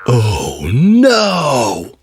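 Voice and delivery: plain reading voice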